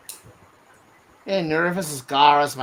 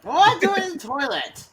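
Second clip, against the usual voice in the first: high squeaky voice. Pretend. Mock indignant reply in put-on voice.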